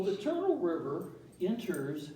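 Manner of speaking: ordinary talk, nothing marked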